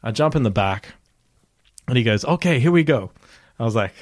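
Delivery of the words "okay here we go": higher-pitched driver send-off; imitating eager driver. Driver setting off from the airport, imitated high and eager. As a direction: lift the voice into an upbeat driver impression.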